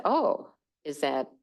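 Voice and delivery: normal talking voice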